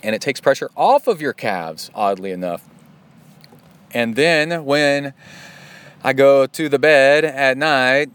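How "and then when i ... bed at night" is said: slipping into a Swedish accent. Real, not acted. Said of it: "almost got into my Swedish accent".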